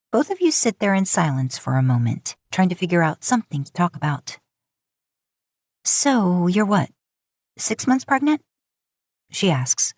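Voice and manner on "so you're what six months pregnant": higher, fake-innocent jab; snide Lisa. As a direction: needle her, higher pitch. Lisa's snide question, higher.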